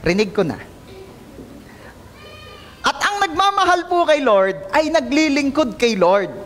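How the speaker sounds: normal talking voice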